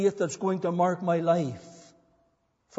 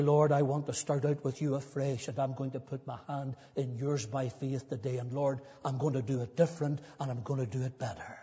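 softer, lower voice of private prayer; quiet personal prayer; 4 semitones lower, 7 dB quieter than usual. Acted. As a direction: pray it quietly, just to the Lord. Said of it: "come back to the Lord quietly and personally".

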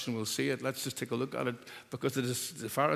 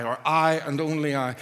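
both I's pressed, a bit louder; stress on I; 3 semitones higher, 5 dB louder than usual. Shown as a demonstration. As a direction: land hard on each I. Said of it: "the emphasis".

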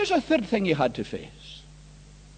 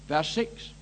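ordinary talk, nothing marked

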